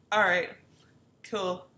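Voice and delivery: mock loud dismissal. Brushing off the ex.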